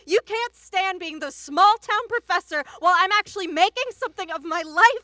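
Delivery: high, loud, contemptuous tirade; scornful yelling. Acted. Wife belittling small-town professor husband, scornful yelling. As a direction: escalate to a furious yell.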